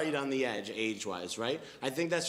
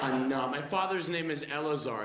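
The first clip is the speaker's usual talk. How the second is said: ordinary delivery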